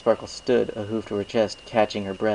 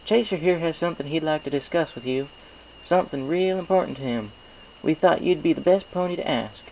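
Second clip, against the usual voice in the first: higher, homey farm-mare voice; Applejack friendly; 8 semitones higher than usual. A narrator's reading. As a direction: cut in kindly but firmly.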